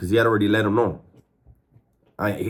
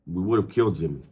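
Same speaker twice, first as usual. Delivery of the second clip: ordinary talk, nothing marked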